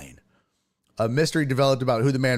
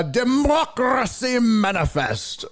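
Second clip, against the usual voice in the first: high, theatrical mock orator with rolled Rs; hammed-up, booming impression of a pompous arrestee; Jack Carlson; 8 semitones higher, slower than usual. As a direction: proclaim it grandly, drawn out, rolling the Rs. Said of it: "I'm doing this accent".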